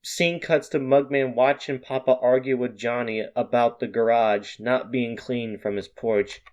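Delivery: plain reading voice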